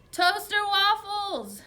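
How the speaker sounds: very slow, high, booming yell. A narrator's reading. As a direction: bellow the words out long. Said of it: "Dad yelled louder".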